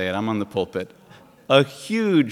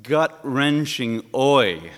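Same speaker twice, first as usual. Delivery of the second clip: slow, loud, high preacher's call; a slow loud ringing sermon climax; preaching; 4 semitones higher, 7 dB louder, slower than usual. Real, not acted. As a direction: proclaim it slowly like a call. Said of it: "preaching climax".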